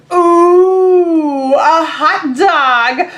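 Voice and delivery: loud, very slow, thrilled cartoon pigeon; a greedy bird spotting food; excited pigeon. Teacher voicing Pigeon thrilled at finding a hot dog.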